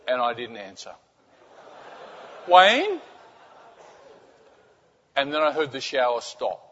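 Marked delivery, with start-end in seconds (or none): 2.5-3.0 high, louder rising call; alarmed calling out. Acted. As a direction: imitate a worried wife demanding an answer. Wife calls out in the dark.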